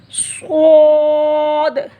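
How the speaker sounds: स्वाद drawn out very slow, thick and heavy; heavy letter. Shown as a demonstration. Teacher contrasts the heavy letter for students. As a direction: stretch स्वाद with a full heavy s. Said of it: "वो मोटा पढ़ा जाएगा".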